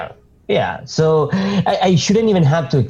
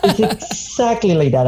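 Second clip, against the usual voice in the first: laughing; 7 semitones higher than usual. Real, not acted. Agreeing, laughing about Boston comparison.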